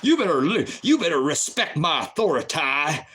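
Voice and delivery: bossy cop caricature with swooping pitch; mocked cop. Host imitating cops who demand respect, mocking caricature. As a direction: puff it up like a pompous officer. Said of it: "And those cops".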